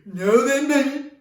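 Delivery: loud, high whingy dragon; Gringer the Whinger. Acted. Dragon announcing bedtime mischief to Mammy, whinging. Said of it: "And Gringer would say".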